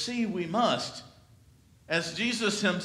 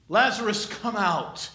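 raised call; 5 semitones higher, 5 dB louder than usual. Acted. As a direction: call the name out loud. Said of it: "he cries out for that".

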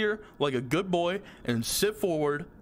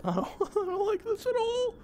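nervous laugh. Real, not acted. Laughing scared in game.

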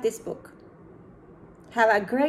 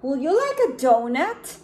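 bright, louder offering voice; Panda offering; 4 semitones higher, 5 dB louder than usual. Acted. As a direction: offer the donut cheerfully, rising. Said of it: "said Panda".

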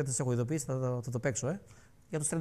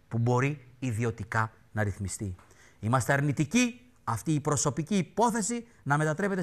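formal reading; 3 semitones lower than usual. Retold or imitated. Reads party statement pompously.

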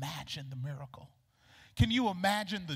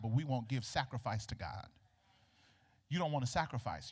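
ordinary talk, nothing marked

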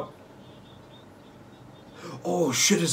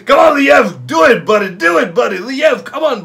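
loud high cheering for a player; excited cheering; 7 semitones higher, 6 dB louder than usual. Real, not acted. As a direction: yell encouragement at the screen.

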